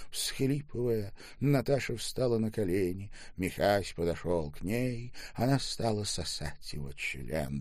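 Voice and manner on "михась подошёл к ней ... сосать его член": usual speaking voice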